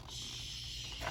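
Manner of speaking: quiet hushing sound; breathy shh; soft shush. Acted. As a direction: shush them off to sleep. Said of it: "hushing the owls to bed".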